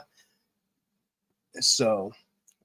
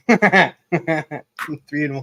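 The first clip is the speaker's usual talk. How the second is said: laughing; 4 dB louder, much slower than usual. Real, not acted. Joking about a dirty meaning.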